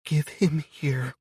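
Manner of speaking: high, slow shaky voice; unsteady voice. A narrator's reading. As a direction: tremble holding back fear. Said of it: "Her own voice is unsteady".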